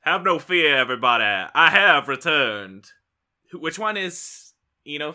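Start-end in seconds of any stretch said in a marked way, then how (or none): 0.0-2.9 heroic return. Acted. Gladiator returning to the arena, proud declaration. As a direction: announce yourself like a champion. [name]'s grand hero return.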